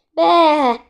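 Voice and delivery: high, loud sheep's "baa"; startled lamb bleat. A narrator's reading. Little lamb jumping back from the bottle, startled bleat. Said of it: "ugrott hátra ijedten Micike".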